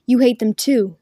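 ordinary delivery

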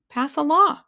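loud, high, eager suggestion; bright suggestion. A narrator's reading. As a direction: pipe up brightly with the idea. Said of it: "suggested brightly".

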